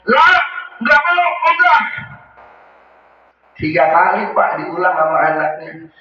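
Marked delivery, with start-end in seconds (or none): 0.1-1.8 stubborn refusal. Retold or imitated. Dying imam refusing his son's prompting, stubborn. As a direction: blurt it out as a dying old man.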